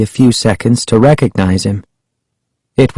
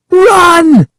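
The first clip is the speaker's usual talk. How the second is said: very high, loud cry; drawn-out high shout of alarm; urgent cry; 5 dB louder than usual. A narrator's reading. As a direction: yell a warning at the top of the range. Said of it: "Sari cried".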